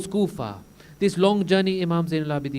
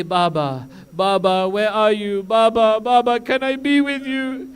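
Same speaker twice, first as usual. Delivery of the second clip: put-on child crying for father; 4 semitones higher, 4 dB louder than usual. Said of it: "She cries for her father".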